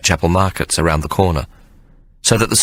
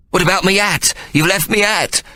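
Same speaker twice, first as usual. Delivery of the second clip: much higher, muffled wail; muffled cry; 12 semitones higher than usual. Acted. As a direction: cry out through a scarf. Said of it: "Ikey cried in a muffled voice".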